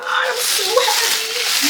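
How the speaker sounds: high, slow squeal of joy. Real, not acted. Seeing pink pots.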